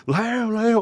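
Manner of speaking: high frantic call of a name. Retold or imitated. Friend calling out for Léo, high and loud. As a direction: shout the name across the dark, alarmed.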